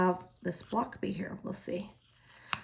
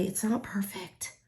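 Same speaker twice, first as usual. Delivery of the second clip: relieved whisper; 8 dB quieter than usual. Real, not acted. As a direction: breathe it out in a whisper. Relieved after placing sticker.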